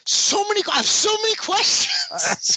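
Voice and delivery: overwhelmed disbelief; high exasperated pitch. Real, not acted. Exasperated about the plot.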